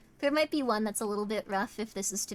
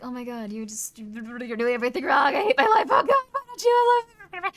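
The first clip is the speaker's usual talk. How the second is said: hammed-up needy guy; 8 dB louder than usual. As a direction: wail like a clingy boyfriend falling apart. Acting out needy fella, building.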